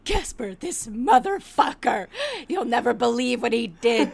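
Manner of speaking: slow, loud, hammed-up indignation; imitated outrage. Friend phoning about Casper's betrayal, mock outrage. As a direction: build to a loud, appalled reveal. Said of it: "incredibly upset".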